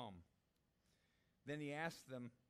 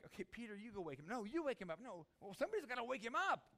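raised, higher-pitched squabbling that builds louder; bickering disciples; 8 semitones higher, 6 dB louder than usual. Acted. Disciples argue over who wakes Jesus, agitated and raised.

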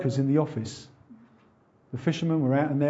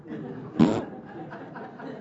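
breathy chuckle; 6 semitones lower, 6 dB quieter than usual. Real, not acted. Laugh at own joke.

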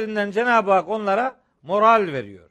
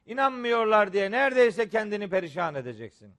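normal talking voice

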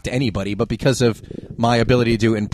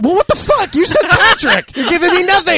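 very high-pitched, incredulous complaint; exasperated protest. Real, not acted. Host complaining about getting nothing, high exasperated protest. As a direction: shoot the pitch up in laughing exasperation. Mock exasperation at co-hosts' name choice.

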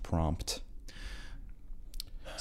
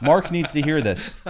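loud, high, self-important protest; indignant mock insistence; 6 semitones higher, 5 dB louder than usual. Podcaster insisting to partner about a fan, indignant. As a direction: insist as a deluded podcaster would. Acted quarrel defending the podcast.

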